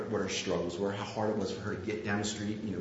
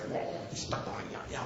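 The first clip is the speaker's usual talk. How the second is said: muttered complaint; 7 dB quieter than usual. Retold or imitated. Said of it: "But I was like".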